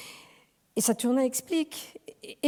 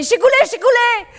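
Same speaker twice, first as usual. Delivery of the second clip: high, loud call up to a window; knocking at night, calling for help; 13 semitones higher, 12 dB louder than usual. Acted. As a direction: shout the neighbour's name. Calling neighbour at door.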